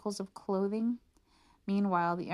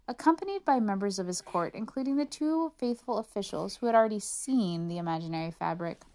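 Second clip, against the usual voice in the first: usual speaking voice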